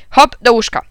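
loud, brisk bedtime command; shouted order. A narrator's reading. Dad sending his son to bed, called out loudly. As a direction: call out an order to a child. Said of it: "krzyknął tata".

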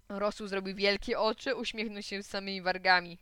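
ordinary delivery, nothing marked

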